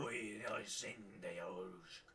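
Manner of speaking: a hint of deep giant speech; lightly voiced giant talking. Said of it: "That sounded like deep speech to me".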